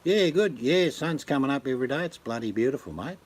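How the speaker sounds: broad Queensland Aussie, starting high; cheery Queensland mate talk; Queensland accent. Shown as a demonstration. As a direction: chat like a laid-back Queensland local. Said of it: "That's Queenslander".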